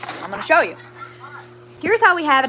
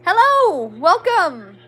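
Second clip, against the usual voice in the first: excited greeting; 6 semitones higher than usual. Real, not acted. Greeting new stream viewers.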